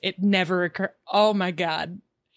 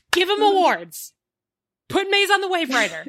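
campaign rallying cry; 8 semitones higher than usual. Real, not acted. Podcaster campaigning for genre actors, rallying cry. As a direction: call it out like a slogan.

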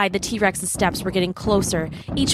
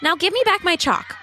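put-on high, demanding little girl; 11 semitones higher than usual. A narrator's reading. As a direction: squeak out a kid's demand. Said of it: "Bella said".

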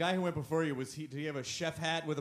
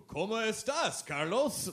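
condescending Spanish; 6 semitones higher, slower than usual. Retold or imitated. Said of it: "condescending to be like".

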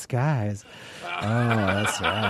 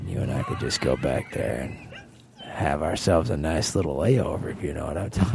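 mock seductive; 9 semitones lower than usual. Seducing flight attendant in story.

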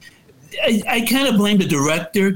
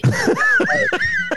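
high laugh. Real, not acted. Laughs at his own joke.

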